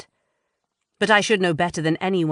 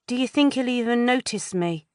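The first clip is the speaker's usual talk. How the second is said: put-on higher, girlish anxious voice; lightly raised young girl's voice; teen girl voice; 7 semitones higher than usual. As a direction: voice a nervous teenage girl.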